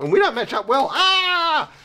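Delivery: high, loud hammed-up panicked yelp; mock panic cry.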